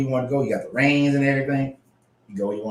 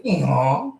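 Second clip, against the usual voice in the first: short rising donkey bray; donkey sound. Acted. Ending the donkey's question with a playful bray. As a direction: finish with a quick bray.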